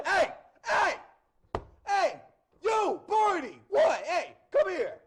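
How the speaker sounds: put-on high, loud hollering Boston kid; thick Boston accent. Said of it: "talks with a thick Boston accent".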